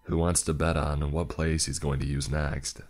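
plain reading voice

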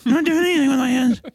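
put-on high nervous kid caught out; high flustered Buster. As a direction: squeak out a guilty denial. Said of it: "He's like".

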